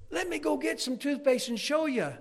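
high, eager retold boy; cocky kid. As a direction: offer it like an excited little kid. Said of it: "kind of cocky kid".

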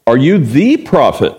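"the" leaned on, falling tune; stress on THE. Shown as a demonstration. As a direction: hit "the" in "the prophet". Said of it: "notice the prophet".

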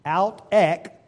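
ek, the Greek word for out, crisp e and hard k. Shown as a demonstration. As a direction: say ek, short and crisp. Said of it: "but in the Greek".

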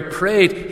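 "prayed" said alone, lifted and stressed; single word "prayed", hit hard. Shown as a demonstration. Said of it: "just emphasizing that word there".

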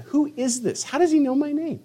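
high-pitched, puzzled questions; startled bewilderment. Acted. Saul hears an unknown voice say his name, acted bewildered surprise. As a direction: voice jumps up as if caught off guard.

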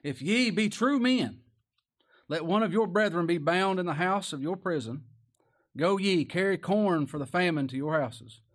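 loud, high preached scripture; full-voiced pulpit reading; preaching at full force. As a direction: proclaim it to the back pews.

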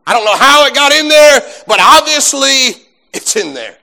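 high, loud plea of innocence; loud protest. Acted. Acting out brothers protesting innocence.

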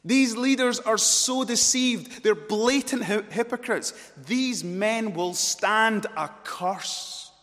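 loud, high, thundering denunciation; full-force preaching. Real, not acted. Preacher condemns deceived leaders to congregation, thundering. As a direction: preach it in a slow, mounting shout.

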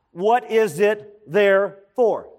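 slow, separated words, "there" and "for" set apart; spaced-out words. Shown as a demonstration. Preacher teaches church to question "therefore", spacing out the words. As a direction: split "there for" into two deliberate words.

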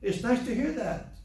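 high, overdone approval; sugary mock praise; mock impressed. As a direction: gush like you're impressed, a bit much.

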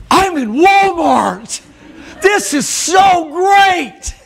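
high, loud and very slow, gasping with delight; giddy Walmart shopper. Retold or imitated. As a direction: gush like a kid in a candy store. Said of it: "it's like".

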